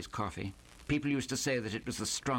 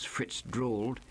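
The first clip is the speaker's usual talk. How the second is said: plain reading voice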